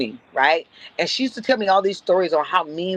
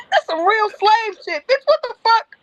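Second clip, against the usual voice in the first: outraged disbelief. Retold or imitated. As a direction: blurt it out appalled, voice shooting up. Outrage at offensive doily gift.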